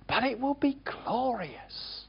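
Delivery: ordinary talk, nothing marked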